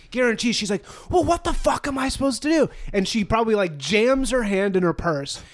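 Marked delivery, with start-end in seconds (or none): 1.1-2.7 high-pitched mock tantrum; acted berating. Boss with no fork for the salad, acted exasperated berating. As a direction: play an exasperated boss losing it. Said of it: "I'm acting out the berating".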